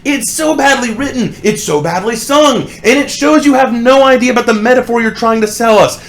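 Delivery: high, strained outburst of disbelief; exasperated rant. Real, not acted. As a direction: rant in disgust at the lyrics. Ranting about badly written song.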